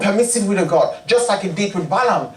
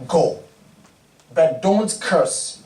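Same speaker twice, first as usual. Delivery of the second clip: slow solemn command; 3 semitones lower, much slower than usual. Acted.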